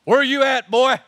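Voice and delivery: loud Southern holler. Pretend. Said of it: "in Southern redneck slang".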